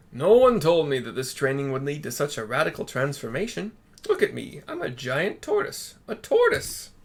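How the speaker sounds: loud, rising panicked student; Soroban voice. Acted. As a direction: build to a shrill wail. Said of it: "says Soroban".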